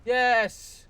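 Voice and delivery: sudden high, loud shout of joy; excited shout. Real, not acted. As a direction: cheer as the trophy pops. Trophy pops after a kill.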